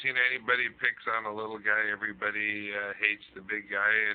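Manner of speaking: usual speaking voice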